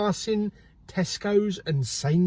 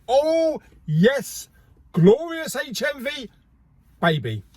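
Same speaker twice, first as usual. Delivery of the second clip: overexcited; 8 semitones higher than usual. Real, not acted. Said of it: "calm down, [name]. You're getting too".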